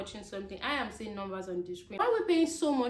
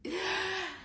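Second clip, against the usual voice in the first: high laugh; 9 semitones higher than usual. Real, not acted. Laughs after Bournemouth accent joke.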